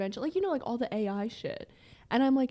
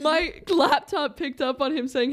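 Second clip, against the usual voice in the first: normal talking voice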